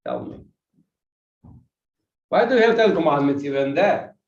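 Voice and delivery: ordinary delivery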